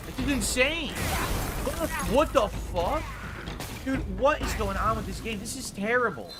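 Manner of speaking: high-pitched outraged yelling at the game; furious rant. Real, not acted. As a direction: screech in disbelief at each death. Gamer raging after dying again.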